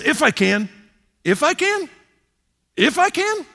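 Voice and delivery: Jesus repeating the father's doubt, incredulous; incredulous echo. Acted. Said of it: "that's when Jesus says".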